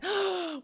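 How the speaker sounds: high, theatrical gasp; shocked gasp. Acted. Streamer reacting to chat's spooky theory, shocked gasp.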